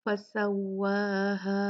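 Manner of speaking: slow "फसव्वाहा" with the final "हा" held two counts; "फसव्वाहा" chanted, long ending; two-count madd. Shown as a demonstration. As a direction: stretch the vowels of "फसव्वाहा" in recitation. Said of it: "दो count मद करके".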